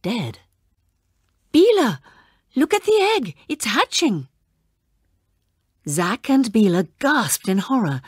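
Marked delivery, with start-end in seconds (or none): 1.5-4.3 excited call. Acted. Child calls Bela to the hatching egg, high excited cry. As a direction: shout the news, thrilled and alarmed.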